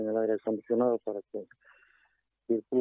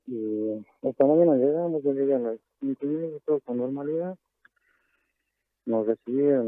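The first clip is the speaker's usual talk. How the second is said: normal talking voice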